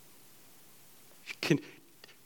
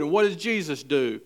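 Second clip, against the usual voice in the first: usual speaking voice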